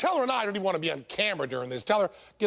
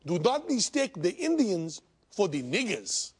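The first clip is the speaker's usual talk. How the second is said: put-on bullshit Indian accent; 4 semitones higher than usual. Comedian as Gandhi telling everyone off in a mock Indian accent. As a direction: protest in a hammed-up Indian accent. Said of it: "my bullshit Indian accent".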